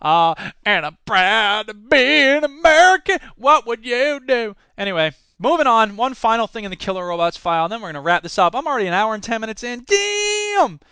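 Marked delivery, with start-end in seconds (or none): 0.0-4.6 slow, lightly put-on proud American; slow sarcastic patriot voice; mock patriot. 9.8-10.8 high, sudden frustrated yelp; squeaky exasperated damn; exclamation. Real, not acted. Frustrated outburst.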